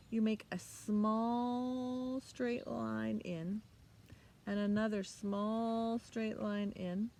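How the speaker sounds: very slow, drawn-out teacher talk; slow demonstration. Shown as a demonstration. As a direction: stretch each stroke out. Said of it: "teacher demonstrates slowly".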